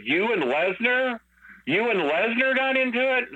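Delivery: high, rising disbelieving question; retold incredulity. Caller hearing about the fight with Lesnar, incredulous. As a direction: imitate an astonished caller squeaking up in pitch.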